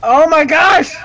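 high, loud shock; loud startled yell; startled outcry. Real, not acted. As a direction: cry out in alarm. Startled by screen in game.

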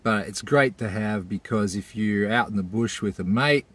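ordinary talk, nothing marked

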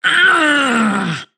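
high crying scream; distressed wail. Acted. Forced to go into his mouth, distressed wail. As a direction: wail out in protest.

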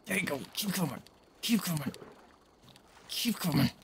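hushed, coaxing the fish closer; soft coaxing. Real, not acted. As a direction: lure it in gently.

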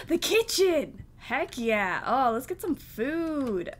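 loud, drawn-out cheer; delighted. Real, not acted. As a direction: exclaim slowly with glee at the discovery. Delighted to find kitchen.

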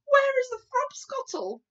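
high, loud demand; giant's cry. A narrator's reading. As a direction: call out indignantly for a drink. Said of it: "the B F G cried".

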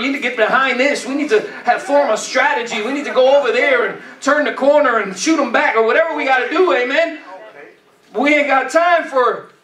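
ordinary talk, nothing marked

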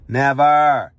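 a single loud, emphatic "Never"; fierce loud final word; loud vow. Real, not acted. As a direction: slam the word down. Vowing his kids never have stepdaddy.

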